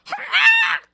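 shrill, very high cheer; shouting. Acted. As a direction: shriek it out. Griffin's exclamation.

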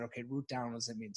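thinking it over alone on the mat; quiet inner voice. Retold or imitated. On the mat before the pose, quietly asking himself.

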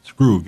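Scroog with a hard final g; hard G pronunciation. Shown as a demonstration. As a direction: end Scroog on a hard g, not a j. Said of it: "was to be pronounced Scroog".

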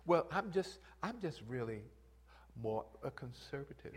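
quiet, prim excuse; mock reserved churchgoer. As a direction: lightly mimic a shy conservative. Mocking a reserved congregant.

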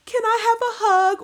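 put-on high childlike plea; imitating little girl. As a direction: ask like a small tearful child. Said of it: "telling her mom, like".

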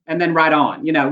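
ordinary talk, nothing marked

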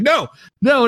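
high startled no-no; mock alarm. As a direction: yelp it like you're dodging a bite. Retells refusing the biting creature.

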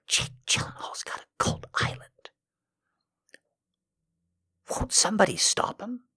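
mock alarmed hushed whisper; conspiratorial whisper. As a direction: whisper it like a frantic warning.

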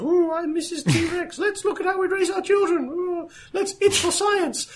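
very high squeaky toy dinosaur voice; silly toy voice. Pretend. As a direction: squeak it out like a kid playing with dinosaur toys. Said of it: "playing with dinosaur toys".

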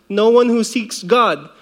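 ordinary delivery

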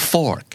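FORK with the middle R sounded; American-style FORK, clear r-sound. Shown as a demonstration. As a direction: curl the R before the K in FORK. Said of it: "มันจะมี R ที่อยู่กลางคำด้วย".

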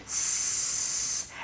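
long, quiet hiss; a drawn-out whispered s; s hiss held steady. Shown as a demonstration. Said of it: "run my arm down for the S".

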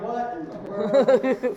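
slow, puzzled mock disbelief; a touch of bewildered surprise. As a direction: act baffled by the sign. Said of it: "I was like".

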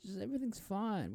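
high-pitched, squeezed president parody; absurdly high voice for a president; mock president's speech. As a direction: play a president brushing it all off, way up high. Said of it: "like when a president's giving, like, the State of the Union".

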